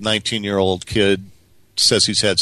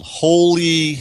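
imitating a kid's startled yell at the stars; retold yell; 8 semitones higher, 4 dB louder than usual. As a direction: belt it out like an amazed kid, half-mocking. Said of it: "at the top of his lungs, he yelled".

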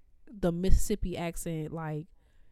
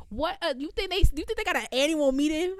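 incredulous; 7 semitones higher than usual. Real, not acted. Incredulous rhetorical question.

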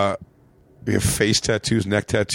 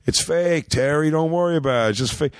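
higher-pitched dismissive reassurance; placating stepfather; 6 semitones higher than usual. Retold or imitated. Stepfather covering for stepson to mom, placating. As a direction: imitate a stepdad smoothing things over.

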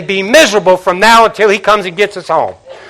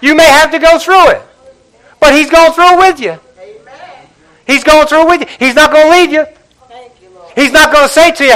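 raised, impassioned preaching voice; 7 semitones higher than usual. Acted. Pastor preaching hope to the congregation, impassioned.

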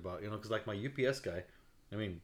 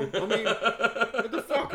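high, slow, laughing what the fuck; laughing disbelief; 13 semitones higher, 10 dB louder, much slower than usual. Real, not acted. Telling his friend about the new boss, laughing disbelief.

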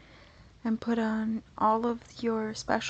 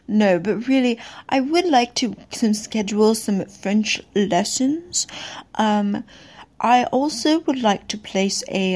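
fake French accent; 10 dB louder than usual. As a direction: lay on a thick fake French accent, loud and proud. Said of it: "I have been working on my French accent".

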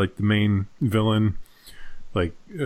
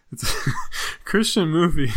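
breaking into laughter while talking; laughing; 6 semitones higher than usual. Real, not acted. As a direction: try to get the words out through giggles. Cracking up while describing film.

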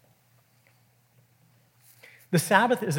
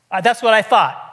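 louder, mock-defiant comeback; acted-out vindicated jab; angry retort; 3 semitones higher, 5 dB louder than usual. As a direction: imitate a triumphant put-down. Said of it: "Jesus is angry".

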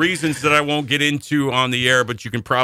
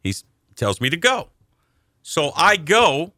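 ordinary talk

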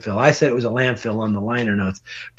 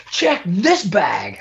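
slow, raised-pitch mock call; retold call-out; 5 semitones higher, much slower than usual. Bug taunting bouncers checking bags, drawn-out mock call.

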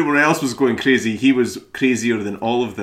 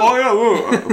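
mock Sober Simon; 13 semitones higher, 7 dB louder than usual. Echoing the Sober Simon bit.

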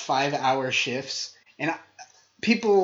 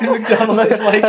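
laughing; 6 semitones higher, 9 dB louder than usual. Real, not acted. Lead-in in high laughing voice.

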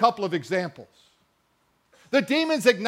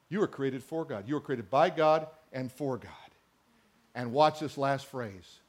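ordinary delivery

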